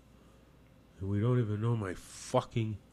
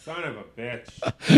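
frustrated curse; 9 dB louder, slower than usual. Real, not acted. Phone cuts out, curses.

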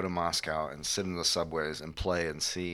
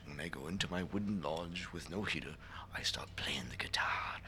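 unvoiced whisper, lower; whispered confession; 4 semitones lower, 11 dB quieter than usual. Prisoner in a cold lodge whispering sadly. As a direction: hush it like a secret at night. Sad gulag story.

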